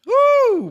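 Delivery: very high whoop of disbelief; high exasperated woo. Real, not acted. Caller overwhelmed by problems, high exasperated whoop. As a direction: yelp a high woo at it all.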